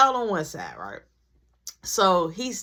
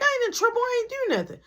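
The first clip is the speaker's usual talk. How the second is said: indignant denial; 13 semitones higher, faster than usual. Retold or imitated. Denying to the classmate.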